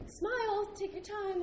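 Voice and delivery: high-pitched imitation of a coaxing lady; retold chirpy coaxing. Photo lady telling the traveler to smile, high-pitched. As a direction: coax in a lifted, high voice.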